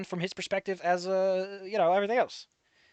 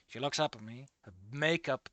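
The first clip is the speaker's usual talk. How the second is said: hushed story reading; 6 semitones lower, 9 dB quieter than usual. A narrator's reading. Rereads the line more dramatically.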